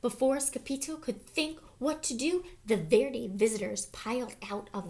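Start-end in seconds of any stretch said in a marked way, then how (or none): none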